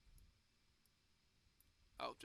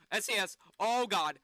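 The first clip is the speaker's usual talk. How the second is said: usual speaking voice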